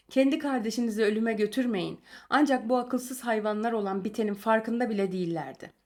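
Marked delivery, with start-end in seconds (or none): none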